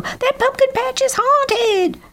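very high, panicked crow voice; shouting crow. A narrator's reading. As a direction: squeal the warning in fright. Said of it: "shouted Lucy Crow".

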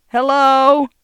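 shouted hello, high and loud; loud call. Acted. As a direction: call out loudly. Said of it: "loudly into the speaker".

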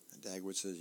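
ordinary talk, nothing marked

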